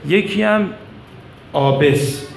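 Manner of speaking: ordinary talk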